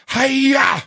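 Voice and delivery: high, loud mock karate cry; karate yell. Opponent trying to scare him, hammed-up karate yell. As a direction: bark a cartoon martial-arts shout. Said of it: "Like that, like trying to scare me".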